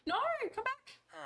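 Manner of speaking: high squeaky plea; calling after. Real, not acted. Begging a fleeing villager to return, high call. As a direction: call it back in a panicky high voice.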